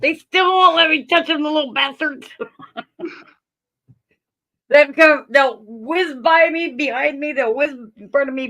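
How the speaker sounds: amused, high-pitched mock griping; laughing complaint. Real, not acted. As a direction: grumble through real laughter. Joking about untouchable pets amid laughter.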